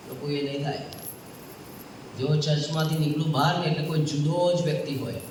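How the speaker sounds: usual speaking voice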